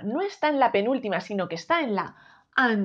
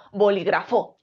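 "bolígrafo" stressed on FO; bo-li-gra-FÓ; stress on last syllable; 3 semitones lower than usual. Shown as a demonstration. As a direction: land on final FO in "bolígrafo". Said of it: "creemos que es fo".

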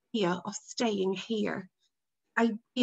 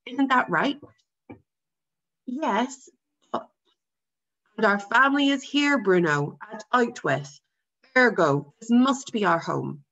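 louder, lecturing father voice; firm father voice; 2 semitones higher, 5 dB louder than usual. Acted. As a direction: lay it down like a stern father.

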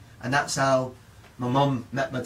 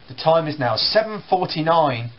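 ordinary talk, nothing marked

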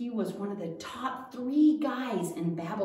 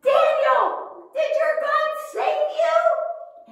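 much higher, loud shout down a pit; yelling down; 8 dB louder, slower than usual. Acted. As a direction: call down into the lions' den. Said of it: "he yelled down".

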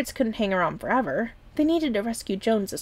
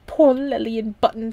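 louder, lightly voiced grieving captain; a hint of an old captain lamenting; sorrowful captain; 2 semitones higher, 6 dB louder than usual. A narrator's reading. The captain mourning the lost pair, louder lament.

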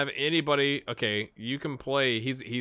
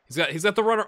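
excited commentary; 8 semitones higher, 6 dB louder, faster than usual. Real, not acted. Live highlight play-by-play.

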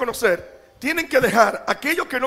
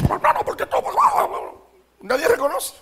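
mock complaining spouse; 8 semitones higher, slower than usual. Mocking a spouse who complains.

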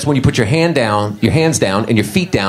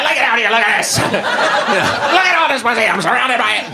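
much higher mock brag; hammed-up boasting; 8 semitones higher than usual. Comic waving it around, hammed-up boasting. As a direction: show off shamelessly. Flaunting while exposed.

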